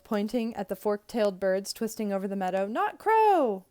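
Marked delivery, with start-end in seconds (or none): 2.7-3.7 high, loud gleeful yell; drawn-out high holler. A narrator's reading. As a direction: shout it out across a meadow. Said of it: "I yelled at a yellow bird".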